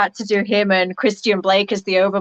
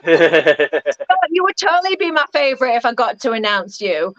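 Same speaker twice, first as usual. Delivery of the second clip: laughing teasing reply; 6 semitones higher than usual. Acted. Jokingly replying to listener comment.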